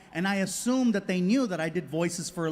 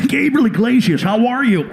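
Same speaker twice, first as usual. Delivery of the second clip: booming, higher-pitched phone greeting; loud guy; 3 semitones higher, 11 dB louder than usual. Retold or imitated. Sound guy greeting the comedian on a call, very loud. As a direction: bellow it, much louder than normal. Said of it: "is that he's loud".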